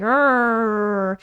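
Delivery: mock angry bear roar; hammed-up bear snarl at the player; bear growl. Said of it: "They're like".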